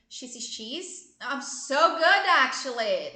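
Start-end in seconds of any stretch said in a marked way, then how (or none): 1.2-3.1 mock boast. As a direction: brag jokingly about getting it right. Mock boast after tongue twister.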